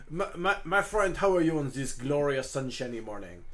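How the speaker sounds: put-on grand French-accented greeting; Hugo in character, cheery. Hugo greets his dragon friend, jovial French accent.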